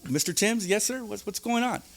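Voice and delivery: anxious inmate voice; nervous high reply. Retold or imitated. Inmate answering the counselor, high and nervous. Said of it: "I go".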